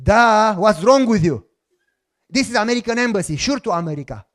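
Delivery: loud, scornful, fast at the end; mocking retort. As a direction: talk down to the consul, loud. Mocking the consul's question.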